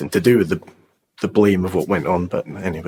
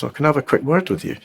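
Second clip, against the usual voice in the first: quick, higher-pitched imitation Australian; Australian accent; 6 semitones higher, faster than usual. Australian stranger asks the man he helped for a word, imitated Australian accent. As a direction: lightly imitate an Australian asking a favour. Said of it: "in an Australian accent".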